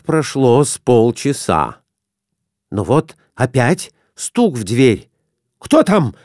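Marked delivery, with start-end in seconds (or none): 5.6-6.1 raised, high call through the door; shout. A narrator's reading. Husband startled by a knock, calling out at the door. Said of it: "закричал Николай Иванович".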